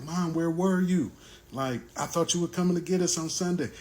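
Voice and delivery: a hint of a child's voice, puzzled; kids asking. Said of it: "the kids go straight into it".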